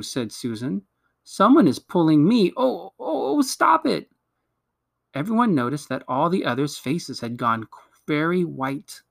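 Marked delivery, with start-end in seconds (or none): 1.2-4.1 alarmed distress. A narrator's reading. Susan feels herself being pulled, increasingly alarmed. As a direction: voice climbing into high-pitched protest.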